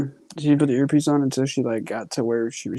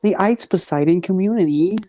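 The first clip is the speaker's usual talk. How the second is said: higher, hammed-up Canadian accent; attempted Canadian accent; 6 semitones higher, 4 dB louder than usual. Asked to speak Canadian, higher mock Canadian accent. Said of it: "Can I hear your Canadian accent".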